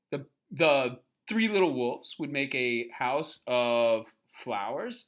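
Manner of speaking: ordinary delivery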